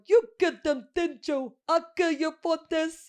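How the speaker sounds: high, loud, garbled melodramatic accusation; toothless kid. Retold or imitated. Quoting him with missing teeth in the mystery, toothless shouting. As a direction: imitate a gap-toothed kid overacting a threat. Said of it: "shape of your missing teeth".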